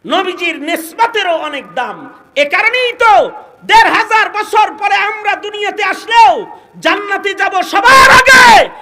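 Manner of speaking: high, shouted sermon; full-force preaching. Real, not acted. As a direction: drive each phrase up to a shout.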